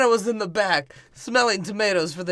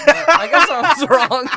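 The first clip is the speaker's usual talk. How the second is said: laughing through the quote; 8 semitones higher, 11 dB louder than usual. Acted. Actor complains, breaks laughing.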